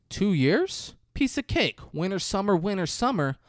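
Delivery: high-pitched mock old-man voice; grandpa voice. Grandpa dismisses jail time to the family, high hammed-up old-man voice. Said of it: "And the grandpa says".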